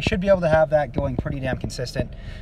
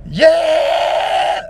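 high loud fry scream on yeah; 9 dB louder than usual. Shown as a demonstration. Showing how the fry scream sounds, high loud yeah. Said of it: "you can hear how there was still kind of that fry there".

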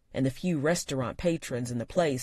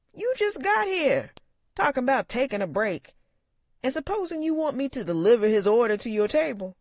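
complaining uncle; 11 semitones higher, 5 dB louder than usual. A narrator's reading. Uncle complaining to his niece, loud and high. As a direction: complain like a put-upon old barkeep. Sam voiced.

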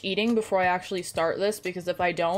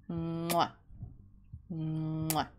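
soft smooching kisses, much softer; kiss sounds; 4 semitones lower, 9 dB quieter than usual. Real, not acted. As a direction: blow little kisses to the pet pug. Kissing pet pug in game.

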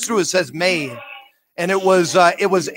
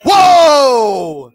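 high, loud whoa falling away; 6 semitones higher, 7 dB louder than usual. Real, not acted. Ghost hunter hears the spirit say Stony, loud amazed whoa. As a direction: let the shock spill out.

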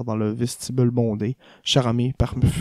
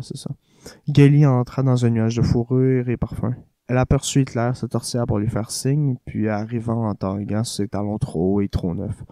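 plain reading voice

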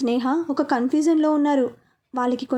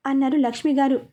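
ordinary delivery

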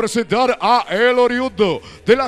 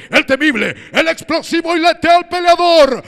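climbing higher and louder to the name; building shout; 5 semitones higher, 5 dB louder than usual. Acted. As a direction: build up then blast the name. Building intro to fighter's name.

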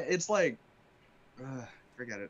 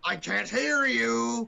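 slow, loud captain bellow; slow captain shout; 9 semitones higher, 6 dB louder, much slower than usual. Pretend. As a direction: bellow it out slowly. Captain demands louder.

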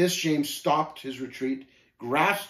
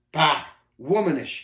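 scornful sneer, a touch louder; 3 semitones higher, 5 dB louder than usual. A narrator's reading. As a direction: spit out the scorn. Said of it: "he sneered".

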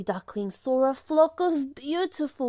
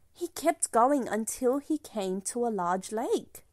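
usual speaking voice

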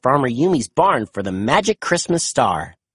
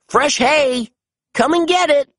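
calling out; 11 semitones higher than usual. A narrator's reading. Calling the cow down.

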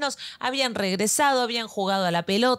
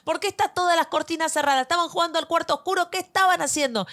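high, insistent mock yelling; retold scolding shout; 8 semitones higher than usual. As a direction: fire off questions like a frantic mother. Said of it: "yo gritaba".

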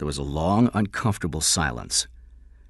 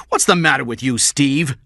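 loud, raised scolding; stern reprimand; 8 semitones higher, 7 dB louder than usual. Acted. Boss coming in on a detective cursing, stern reprimand. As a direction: pull him up sharply.

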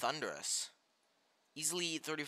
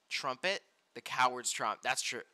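ordinary talk

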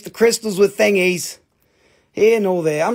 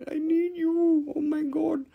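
mock desperate; 11 semitones higher than usual. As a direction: fake heartbroken begging.

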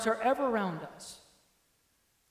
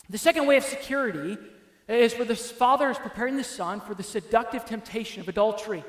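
usual speaking voice

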